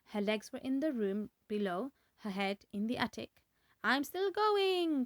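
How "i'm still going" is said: high, slow screechy crone; screechy old voice. A narrator's reading. Grandma screeching from the attic. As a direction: screech it down from above. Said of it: "the old screechy voice".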